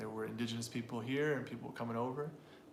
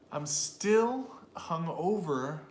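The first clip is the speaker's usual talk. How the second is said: "still" and "hungover" drawn out high and loud; slow stress demonstration; 4 semitones higher, 5 dB louder, much slower than usual. Shown as a demonstration. Teacher has learners listen for stress, slow and exaggerated. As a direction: stretch "still", then lift "hungover", very slowly. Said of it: "What do you guys hear?".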